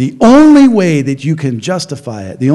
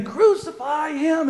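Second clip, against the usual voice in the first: much higher, soft and slow; a sly voice urging from the back, drawn out; hidden voice in crowd; 9 semitones higher, 7 dB quieter, slower than usual. Retold or imitated. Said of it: "you hear again".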